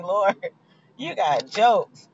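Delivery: slow, drawn-out wry line; wry to God. Retold or imitated. Telling God his timing is a joke, slow and wry. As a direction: drag it out, dry and amused. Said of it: "I could be like".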